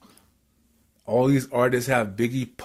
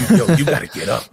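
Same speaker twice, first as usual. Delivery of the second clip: louder, higher retold urging; insistent wake-up call; 4 semitones higher, 7 dB louder, faster than usual. Stranger waking the sleeping rapper on the train, insistent. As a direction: rouse a sleeper with a raised voice.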